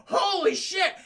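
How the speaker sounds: loud, high exclamation; mock shock. Acting out the kids seeing the goat's leg land, shocked. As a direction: imitate horrified astonishment.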